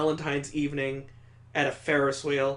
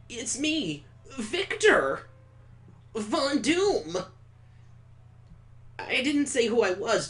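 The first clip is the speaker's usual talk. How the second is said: put-on higher, gushing eager boy voice; eager Victor; 6 semitones higher than usual. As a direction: play a giddy date who can't wait. Voicing Victor in the game.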